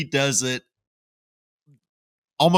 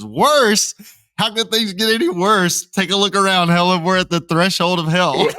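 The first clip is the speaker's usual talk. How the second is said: higher, louder exasperated quote; mock outraged dad; 7 semitones higher, 4 dB louder than usual. Retelling the dad's rant to Helen, mock exasperated. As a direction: play the fed-up dad, a touch hammed. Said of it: "And he says".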